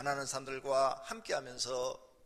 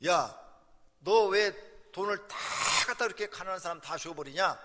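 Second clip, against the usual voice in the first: louder, higher scolding of a son; father yelling at his son for giving everything to the poor, raised and angry; 5 semitones higher, 8 dB louder than usual. Retold or imitated. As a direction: tell him off for giving the money away. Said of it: "화를 내시기 시작하는 거예요".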